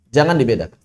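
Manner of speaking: usual speaking voice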